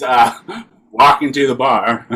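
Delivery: ordinary talk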